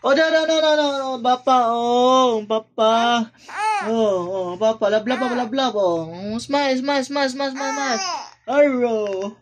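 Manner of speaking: high, loud soothing sing-song. Real, not acted. Father soothing his crying baby, high sing-song baby talk.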